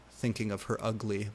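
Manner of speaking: ordinary delivery, nothing marked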